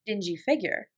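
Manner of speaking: ordinary delivery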